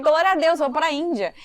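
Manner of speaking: loud, joyful cry of praise; Glória a Deus shouted with joy; joyful exclaim. Retold or imitated. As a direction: burst out in happy relief. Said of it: "abracei ela e falei".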